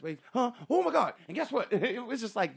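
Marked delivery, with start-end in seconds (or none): 0.3-1.7 imitating Robin Williams. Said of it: "Robin Williams have done that".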